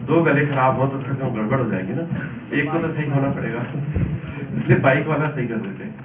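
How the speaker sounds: normal talking voice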